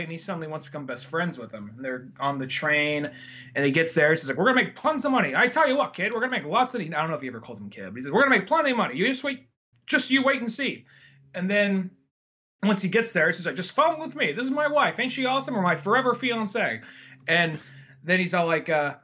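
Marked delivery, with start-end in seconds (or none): 4.4-6.8 high, eager acted promise; hustler's pitch. Acts out excited hustler's promise. 8.1-10.8 high, eager acted promise; hustler's pitch. Acts out excited hustler's promise. 13.6-16.8 high, gushing acted introduction; eager introduction. Acts out eager man introducing wife.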